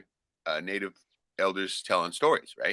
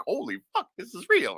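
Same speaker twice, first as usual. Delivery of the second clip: a much higher voice of startled alarm; stunned; 10 semitones higher than usual. Retold or imitated. Son realizing the threat is real, high startled alarm. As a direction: gasp out that it's real. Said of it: "I'm like".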